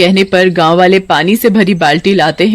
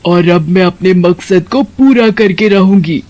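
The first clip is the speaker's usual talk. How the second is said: scheming witch. Acted. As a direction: gloat over your plan. Witch declares her aim.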